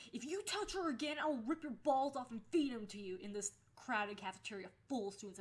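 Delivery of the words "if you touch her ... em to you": loud, higher snarling threat; furious threat. Retold or imitated. Holding the boy by the collar in the cafeteria, furious. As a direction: threaten him through your teeth. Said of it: "I was like".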